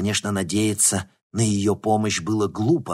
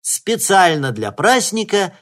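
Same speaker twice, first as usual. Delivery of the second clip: loud, high, ceremonial announcer voice; solemn speech; 10 semitones higher, 10 dB louder than usual. Acted. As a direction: proclaim it like a host on stage. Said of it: "продолжала Белохвостикова".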